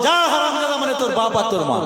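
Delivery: high-pitched exasperated outburst; enraged mother. Acted. Mother at wits' end answers son's question, enraged. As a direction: snap it out high, then fall away. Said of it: "রেগে গিয়ে আর মাথায় ঠিক করতে পারে না".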